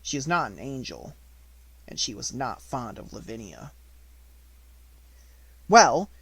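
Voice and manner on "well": high, loud heated opening; fiery retort. A narrator's reading. Sarah rounding on Lavinia, loud fiery retort. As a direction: flare up at Lavinia. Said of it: "with some fire".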